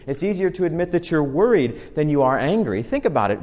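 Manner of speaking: usual speaking voice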